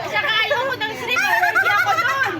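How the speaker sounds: shouting through laughter; laughing shout. Real, not acted. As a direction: laugh and yell the excuse.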